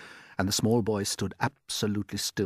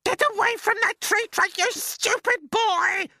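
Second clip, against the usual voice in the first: a far higher, louder aunt's shout; Aunt Spiker shouting; 7 dB louder than usual. Acted. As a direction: screech it out as the nasty aunt. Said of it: "Aunt Spiker yelled".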